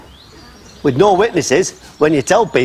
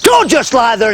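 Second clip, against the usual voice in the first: retold Barnsley command, higher and louder; a no-nonsense Barnsley voice telling him to get up; Barnsley accent giving a firm order; 6 semitones higher, 4 dB louder than usual. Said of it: "A voice that meant business".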